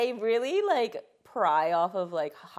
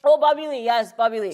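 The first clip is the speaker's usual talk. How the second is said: excited; 4 semitones higher, 12 dB louder than usual. Real, not acted. Relieved at given answer.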